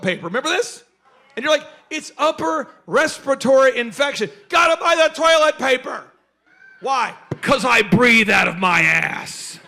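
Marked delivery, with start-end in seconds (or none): 1.9-6.0 loud, raised-pitch mock alarm; mock panic. Shopper rushing for toilet paper, in mock panic. 7.4-9.6 slow, very loud mock declaration; mocking shout. Panic buyer explains toilet paper, in a mocking shout.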